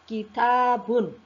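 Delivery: "kitabun" drawn out syllable by syllable, long ta; pronunciation demo. Shown as a demonstration. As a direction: model "kitabun" slowly for learners. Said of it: "nah, kita ucapkan".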